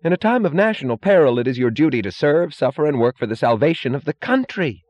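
righteous, high-pitched outcry; Lawson. A narrator's reading. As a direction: burst out self-righteously, high and forceful. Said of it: "Lawson cried suddenly, blindly, righteously".

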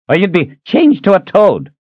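put-on high, sharp old man's retort; snapped. Reuben snapping a denial at Ahern, high and sharp. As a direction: snap back at him.

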